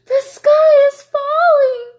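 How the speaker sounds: high, slow, loud bird chorus; panicked birds. Acted. The birds telling the fake officer their alarm, high and loud. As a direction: cry it out like frantic hens. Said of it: "said all the birds".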